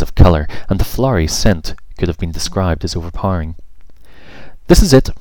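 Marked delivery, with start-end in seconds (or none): 4.7-5.1 high, loud triumphant call; excited announcement. Acted. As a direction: proudly announce they've arrived. Scarlet announcing arrival.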